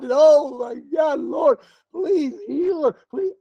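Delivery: high, slow, drawn-out begging; hammed-up wailing prayer; mock pleading moan. Preacher imitating believers begging God for healing, mock moaning plea. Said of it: "moaning and crying and begging".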